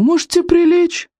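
high, teasing Bertha voice; mock-polite joke. A narrator's reading. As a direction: needle him with fake politeness, pitched high. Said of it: "вежливо пошутила Берта". (высоким голосом, с притворной вежливостью)

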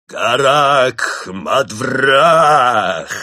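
a monk shouting a dark summoning word; incantation cry. A narrator's reading. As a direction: bellow the spell slowly. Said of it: "вскричал брат Немрод".

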